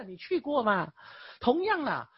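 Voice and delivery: normal talking voice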